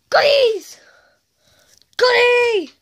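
gasping, high, loud cheer; high excited yelp of glee; greedy delight. Acted. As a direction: squeal at the treats. Characters see piles of sweets.

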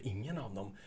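a soft, deflated echo; deflated repeat. Retold or imitated. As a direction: repeat it quietly, let down. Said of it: "Och då tänkte du".

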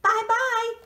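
put-on high, loud baby bye-bye; toddler shout. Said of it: "till he shouted out".

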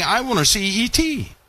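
mild mock of a wide-eyed believer; mock eager believer. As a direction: imitate a naive fan begging to meet E T. Mocks people eager for aliens.

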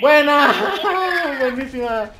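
high, laughing cheer of victory. Real, not acted. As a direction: laugh out the praise. Celebrating the victory.

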